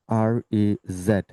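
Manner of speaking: usual speaking voice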